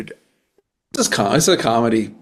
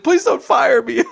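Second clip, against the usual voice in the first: mock panicked plea; 12 semitones higher, 4 dB louder than usual. Begging boss, laughing.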